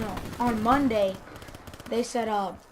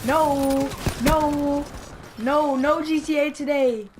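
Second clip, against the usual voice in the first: retold panicky protest; panicked yelling; 3 semitones higher, 3 dB louder, slower than usual. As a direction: refuse it in a half-laughing panic. Panics about swat being called.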